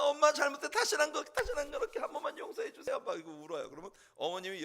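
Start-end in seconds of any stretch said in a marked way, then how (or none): 0.0-3.0 high, loud mock pleading of a child; hammed-up frantic child's apology; tearful begging. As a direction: act out clinging to mum and begging, fading at the end. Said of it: "막 매달려 웁니다".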